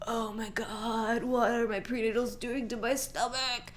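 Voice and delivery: high-pitched, dismayed whine; acted-out dismay. Voicing her waking nauseous self.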